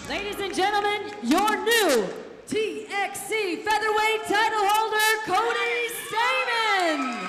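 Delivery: big booming announcer voice, stretching the name; ring announcer call. Acted. Ring announcer to the arena crowd, booming title call. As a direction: crown the new champ to the crowd.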